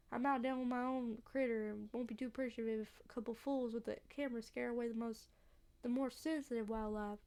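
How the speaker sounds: dry Southern country voice; unwelcoming local with a Southern accent. Acted. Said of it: "a southern accent".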